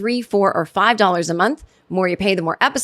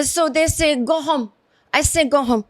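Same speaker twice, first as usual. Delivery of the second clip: mock Spanish accent, higher pitch; struggling second-language English; 6 semitones higher than usual. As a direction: imitate a Spanish speaker fumbling with English. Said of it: "she's struggling to get the verbiage right".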